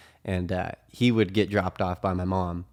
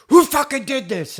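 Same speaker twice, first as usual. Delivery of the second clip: loud, very high imitated yell; freaking out; 9 dB louder than usual. Housemate in the doorway accusing everyone, acted freak-out shout. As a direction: mimic an enraged outburst. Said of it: "freaking the fuck out".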